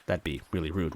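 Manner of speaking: ordinary delivery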